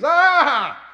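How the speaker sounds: loud high laughing cry of delight. Retold or imitated. Pastor laughs at the scared student, loud laughing cry. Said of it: "And he goes".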